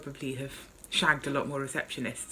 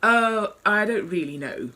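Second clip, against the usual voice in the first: knowing coy tone; 5 semitones higher, 5 dB louder, slower than usual. Acted. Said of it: "in a tone implying ... his suspicions".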